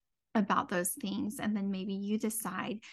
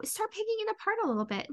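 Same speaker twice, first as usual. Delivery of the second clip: much higher and fast, eager push; excited urging; 12 semitones higher, faster than usual. Shown as a demonstration. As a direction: rush it out high and bright with encouragement. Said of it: "start to pick it apart".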